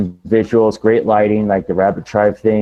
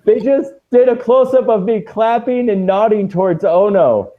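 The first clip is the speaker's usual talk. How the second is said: high, thrilled report; excited; 11 semitones higher than usual. Real, not acted. Wrestler sees his own close-up on TV, excited. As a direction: gush it out, pitch riding high. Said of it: "Okay, [name]'s excited".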